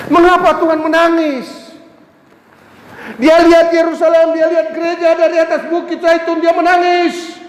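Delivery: impassioned preaching. Acted. Preaching about Jesus weeping. (khotbah penuh gairah, suara tinggi dan keras)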